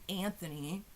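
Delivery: ordinary talk, nothing marked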